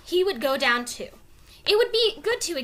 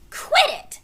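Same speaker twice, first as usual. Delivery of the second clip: irritated protest; 5 dB louder than usual. Acted. Annie pushed, objects.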